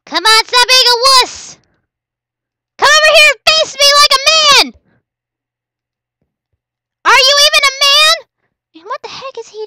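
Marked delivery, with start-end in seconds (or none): 0.1-8.2 high, loud goading; shouted challenge; mock taunt. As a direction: goad them to fight. Taunting opponent.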